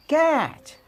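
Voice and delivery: कैच with a high, stretched open 'ai'; model pronunciation. Shown as a demonstration. Teacher showing the ऐ sound, high drawn-out demo. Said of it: "मतलब ऐ उच्चारण आया".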